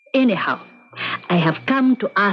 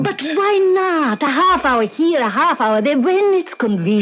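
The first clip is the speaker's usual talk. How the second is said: much higher, coaxing plea; pleading; 8 semitones higher than usual. Acted. Old teacher coaxing her friend to coach her pupil, pleading. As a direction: wheedle him into saying yes. Begging Anton to coach Raphael.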